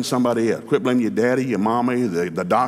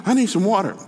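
higher, a hint of a winded, thirsty king; out of breath king; 7 semitones higher, faster than usual. Fleeing king begging the shepherd girl for water, a touch winded. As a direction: plead for water like a man who ran for his life. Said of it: "He's out of breath, man".

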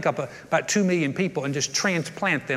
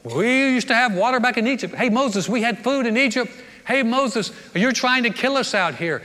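mock accusing, higher and louder; accusing whine; 6 semitones higher, 4 dB louder than usual. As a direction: imitate a crowd blaming Moses for everything. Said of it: "they always blame Moses".

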